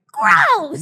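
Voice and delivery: high, loud shouted Gross; gross-out yell. Retold or imitated. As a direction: bellow it like a cartoon kid. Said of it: "'cause it was just going".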